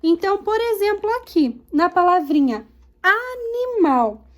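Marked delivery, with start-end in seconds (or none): 3.0-4.3 animal drawn out in syllables; teacher stretching "animal" into a-ni-mal. Shown as a demonstration. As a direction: sound out "animal" for a class. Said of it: "separar as sílabas primeiro".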